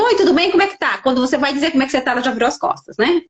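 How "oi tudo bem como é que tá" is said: imitating a cheery passing greeting; chirpy, higher-pitched greeting thrown in passing; cumprimento apressado. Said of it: "a pessoa pergunta". (saudação simpática e apressada, com tom agudo)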